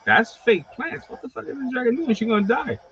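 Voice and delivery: high, alarmed "what is she doing"; startled owner, half laughing at it; alarmed disbelief. Retold or imitated. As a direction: freak out at the fake plants, voice jumping up. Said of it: "and I'm like".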